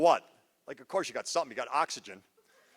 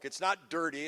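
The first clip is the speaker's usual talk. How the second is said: ordinary talk, nothing marked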